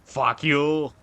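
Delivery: short put-on character voice; lightly caricatured impression voice. Shown as a demonstration. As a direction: say it in a silly altered voice. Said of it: "I'll say it in all the impressions I have".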